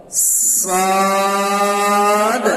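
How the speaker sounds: সাদ held long and sung; drawn-out sad. Shown as a demonstration. Teacher shows a long sung সাদ. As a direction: stretch সাদ slowly. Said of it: "ভালো করে দেখেন".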